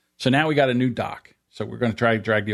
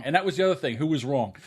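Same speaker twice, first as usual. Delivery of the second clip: usual speaking voice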